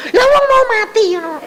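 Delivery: insistent retort. Retold or imitated. Arguing that she will die.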